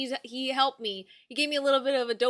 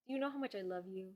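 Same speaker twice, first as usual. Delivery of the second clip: usual speaking voice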